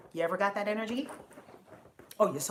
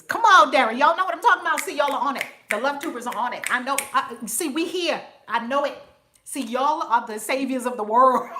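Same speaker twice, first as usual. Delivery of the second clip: loud, higher rallying of the chat; hyped-up cheering; 4 semitones higher, 10 dB louder than usual. Real, not acted. She cheers on her Love Tubers, loud and excited. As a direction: fire up the crowd.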